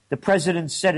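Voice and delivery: ordinary talk, nothing marked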